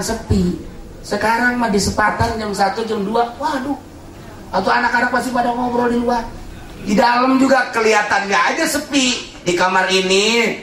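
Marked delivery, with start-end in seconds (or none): none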